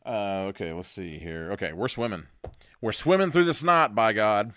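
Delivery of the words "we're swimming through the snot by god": loud, higher mock-heroic declaration; rallying cry. Host announcing the group's choice to swim, rallying cry. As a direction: proclaim it with gung-ho force.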